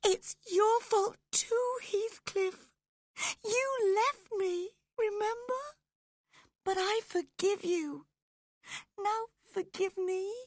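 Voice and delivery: very high tearful pleading; high-pitched weeping woman; sobbing. A narrator's reading. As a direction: sob the blame, then plead for forgiveness. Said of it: "sobbed Catherine".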